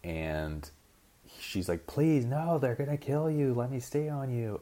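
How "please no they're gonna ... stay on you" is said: high, pleading woman's voice; begging woman. Retold or imitated. Dwan begging Kong to keep her, high and pleading. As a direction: beg him desperately in a high voice.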